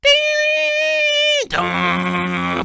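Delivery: very high sung doorbell chime; doorbell ding dong. Acted. Doorbell imitation.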